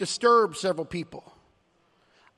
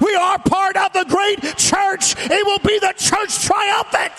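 climbing to a shouted, higher peak; preaching; 7 semitones higher, 5 dB louder than usual. Acted. Pastor rallying the congregation, shouting with rising fervour. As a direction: build the sermon to a roaring finish.

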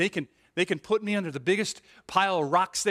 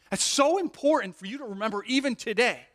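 usual speaking voice

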